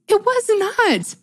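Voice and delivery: mock-indignant imitation of the husband; husband's denial. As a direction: play the husband scoffing at it. Husband voiced denying sass.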